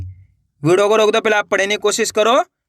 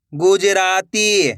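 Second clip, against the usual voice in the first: slow, stretched reading of the whole word; joined-up word; much slower than usual. Shown as a demonstration. Teacher showing learners the finished word, slowly joined up. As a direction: blend the syllables into one drawn-out word. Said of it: "तो मिलके बन जाएगा".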